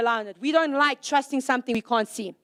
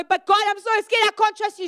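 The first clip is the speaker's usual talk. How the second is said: higher, whiny mock fear; mock scared plea; 7 semitones higher than usual. Believer pleading with God, mock scared whine. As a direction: plead like a frightened child. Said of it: "we go".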